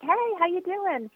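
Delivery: bright high call to a neighbor; calling across the driveway. Retold or imitated. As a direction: call out a cheery greeting across the yard. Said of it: "I shouted across".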